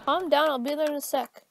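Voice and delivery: ordinary talk, nothing marked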